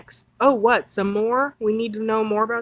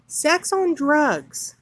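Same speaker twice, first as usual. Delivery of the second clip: slow appalled emphasis; 5 semitones higher, much slower than usual. Real, not acted. Reading workshop title in disbelief.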